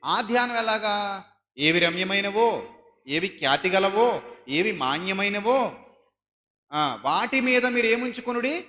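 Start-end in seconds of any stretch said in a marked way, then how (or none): 1.6-8.7 deliberate, stately reading of scripture; solemn scripture reading. Acted. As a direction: intone the verse slowly, building at the end. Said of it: "అంటున్నాడు బైబిల్లో".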